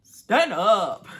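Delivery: sudden loud, emphatic order; loud command. Real, not acted. Viewer telling a rejected contestant off, loud command. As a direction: burst out with it loudly.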